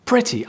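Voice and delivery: pretty said high and leaned on; stress on pretty. Shown as a demonstration. Teacher showing the replacement word, "pretty" said high. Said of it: "replace it with".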